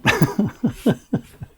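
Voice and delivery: loud, high burst of laughter; real laugh. Real, not acted. Laughs after abrupt next question.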